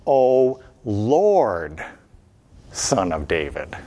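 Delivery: Lord, Son of David sunk deep; low, pleading cry. Shown as a demonstration. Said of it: "What do they call out?".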